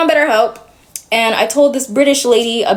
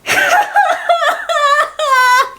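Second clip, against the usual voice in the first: laugh. Real, not acted. Laugh after near-sob.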